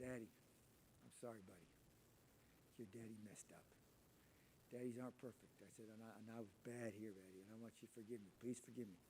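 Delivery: put-on soft, gentle apology to a child; tender apology. Father apologizing to his crying son, soft and tender. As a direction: say sorry softly to your little boy.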